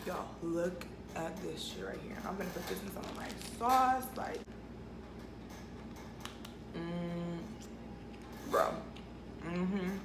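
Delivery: talking while chewing; words round a bite of food; mouth full. Real, not acted. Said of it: "I can't eat and talk".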